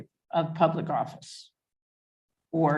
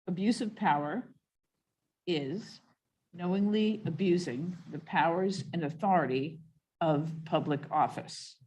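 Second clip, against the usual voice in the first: measured, with pauses between phrases; slow dictation. Real, not acted. Chair rereads the amended wording for the commission, slowly. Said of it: "I'll say it again slowly now".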